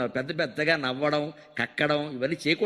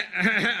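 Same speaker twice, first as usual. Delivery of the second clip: husband's giggle. Retold or imitated. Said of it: "కిసకిసమంటే".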